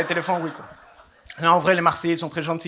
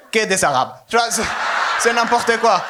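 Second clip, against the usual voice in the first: louder, higher outrage; punchline shout; 5 semitones higher, 3 dB louder than usual. Real, not acted. Comedian ranting to the crowd about Nice, loud. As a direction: shout it with mock indignation.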